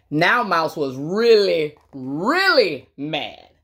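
drawn out slowly, leaning on really; slow emphasis. A narrator's reading. Reading to kids, building up how mad Mouse is. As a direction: stretch each really. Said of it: "slowing for emphasis".

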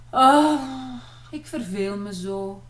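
loud drawn-out sigh, then dropping to resigned words. A narrator's reading. René bored at home with her sisters, sighing. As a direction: heave a big bored sigh. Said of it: "zuchtte René".

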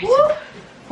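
high whooping cheer; excited cheer. Real, not acted. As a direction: whoop with a laugh. End of video cheer.

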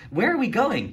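put-on high, curious girl's voice; young student asking where they're headed; Keisha's question. A narrator's reading. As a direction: ask it brightly as a young girl. Keisha asks, narrator voicing her.

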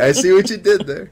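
high, loud knowing catchphrase. Shown as a demonstration. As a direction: hit it like a smug meme line.

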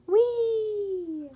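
long high whee, gliding down; drawn-out, high-pitched cry of delight; excited whee. A narrator's reading. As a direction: squeal it out like flying. Flying high in the sky.

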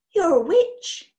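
slow, loud amazed realisation; dawning realisation. Acted. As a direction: realise it slowly. Boy's realisation.

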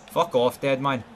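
ordinary delivery

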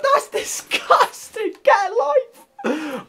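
high, slow, laughing through the words; laughing disgust. Real, not acted. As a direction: squeal it while cracking up. Laughing disbelief at opponent.

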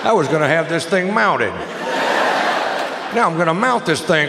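wild shouting rampage, climbing high; frenzied yelling. Acted. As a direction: bellow it like a crazed hunter.

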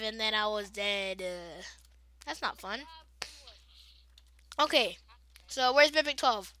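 usual speaking voice

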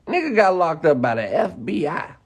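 ordinary talk, nothing marked

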